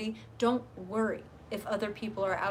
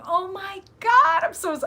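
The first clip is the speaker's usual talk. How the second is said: excited; 11 semitones higher, 11 dB louder than usual. Real, not acted. Excited over viewer's news.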